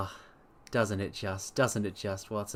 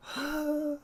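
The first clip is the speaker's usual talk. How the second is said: fond high sigh; 4 dB quieter than usual. Real, not acted. Fond sigh over Holmes.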